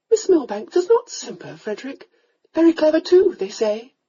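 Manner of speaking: a mother coaxing her son to marry; countess. A narrator's reading.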